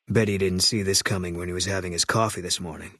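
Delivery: usual speaking voice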